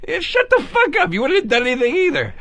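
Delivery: high-pitched retold comeback; mock exasperated dismissal. Telling off his doubting date, mock exasperated. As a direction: brush her off in mock outrage.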